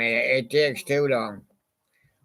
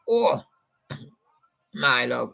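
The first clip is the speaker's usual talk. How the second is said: John's way. Retold or imitated. DJ imitating John singing to his listeners, a light croon. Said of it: "John went".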